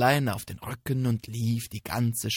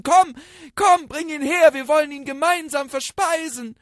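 much higher, loud cunning deer; bold call; 13 semitones higher, 9 dB louder than usual. Acted. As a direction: bluff loudly to trick the tiger. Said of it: "dann rief das Hirschlein". (lautes, listiges Hirschlein)

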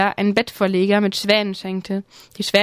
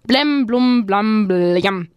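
bird-language sounds; much slower than usual. Acted. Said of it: "höre dir die letzten Kombinationen an".

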